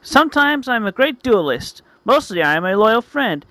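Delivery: high, dramatic hero declaration; hammed-up heroic proclamation; heroic speech. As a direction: build to a high, rousing battle cry. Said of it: "I make my little speech".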